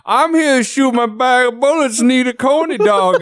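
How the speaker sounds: high, goofy gun-range guy voice; dopey guy voice. Retold or imitated.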